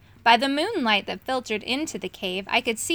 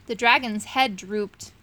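ordinary delivery

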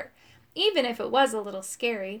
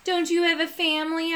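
high, needling singsong taunt; mean teasing, pitched up; 6 semitones higher than usual. A narrator's reading. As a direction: taunt him to make him sad. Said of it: "I taunted".

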